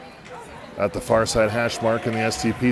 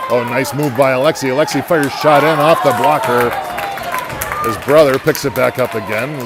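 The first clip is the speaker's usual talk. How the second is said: loud, higher, building to the goal; excited play-by-play; 3 semitones higher, 7 dB louder than usual. Real, not acted. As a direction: call the rush and the score.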